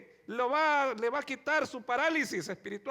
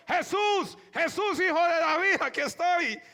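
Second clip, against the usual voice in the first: loud, higher pleading cry; shouting for Jesus; 4 semitones higher, 4 dB louder than usual. Retold or imitated. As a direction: keep shouting over the crowd. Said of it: "Y siguió gritando".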